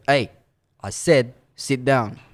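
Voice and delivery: firm, slow repeated order; insist, drawing out each word; policeman's order. Retold or imitated. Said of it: "He goes".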